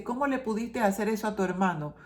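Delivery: ordinary talk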